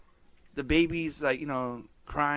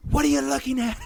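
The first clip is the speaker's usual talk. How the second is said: sudden high mock snap, louder; barking at a staring toddler in jest; 12 semitones higher, 5 dB louder than usual. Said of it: "And I went".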